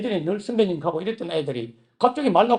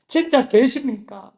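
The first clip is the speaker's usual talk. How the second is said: pleading cry; 5 semitones higher, 5 dB louder, slower than usual. Retold or imitated. Desperate prayer asking if God exists.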